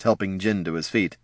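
ordinary delivery

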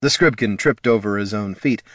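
ordinary delivery, nothing marked

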